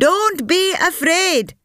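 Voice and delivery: high call to the troops; shouting. A narrator's reading. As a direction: call out high to rally them. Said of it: "Sampath shouted to her troops".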